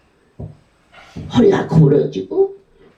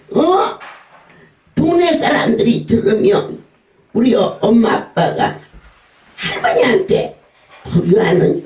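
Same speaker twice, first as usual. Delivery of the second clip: much higher, laughing mock scold; grandson scolding; 5 semitones higher than usual. Grandson scolding grandma for fake death talk, high and laughing.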